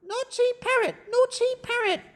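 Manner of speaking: high, light parrot echo; high-pitched parrot singsong; mimicking parrot. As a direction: mimic it back in a squawky parrot voice. Parrot mimics the yelled words.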